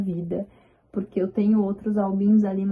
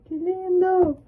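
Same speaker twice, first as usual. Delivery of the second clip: much higher gushing exclamation; delighted squeal; 10 semitones higher, much slower than usual. Real, not acted. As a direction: squeal over the photo. Reveals photo with friends.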